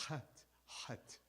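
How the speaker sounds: chet with a breathy throat H, no scrape. Shown as a demonstration. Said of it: "like the Arabs do".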